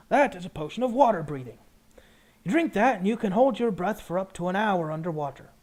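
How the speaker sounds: potion seller. Acted. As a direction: pitch it up as a potion brewer. Potion seller explains the wares.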